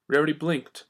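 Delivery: ordinary delivery, nothing marked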